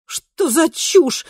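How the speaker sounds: high, drawn-out disbelief; impatient exclamation. A narrator's reading. Kristina dismissing her double's words, impatient outburst. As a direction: throw it out with exasperation. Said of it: "нетерпеливо воскликнула Кристина".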